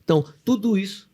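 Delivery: ordinary delivery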